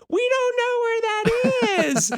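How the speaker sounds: put-on very high, slow, whining child's cry; whiny kids. Kids on Zoom whining to their teacher, high whimpering wail. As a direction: wail it like a chorus of lost kids. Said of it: "twenty kids simultaneously are like".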